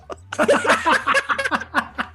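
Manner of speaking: loud high burst of laughter; real belly laugh, cracking up; big laugh. Real, not acted. Laughs at shower video story.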